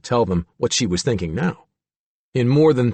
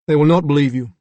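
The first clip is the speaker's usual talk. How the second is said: put-on Arthur's British accent; 4 semitones higher, 5 dB louder, faster than usual. A narrator's reading.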